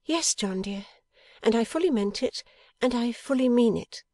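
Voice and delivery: high-pitched sincere assurance; Bella, earnest wife. A narrator's reading. Wife assures husband she still means it, earnest. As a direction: affirm it firmly, pitched well up.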